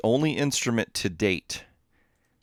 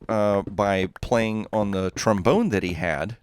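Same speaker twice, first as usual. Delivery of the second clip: ordinary delivery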